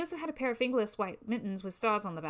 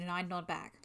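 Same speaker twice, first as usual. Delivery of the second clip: ordinary delivery, nothing marked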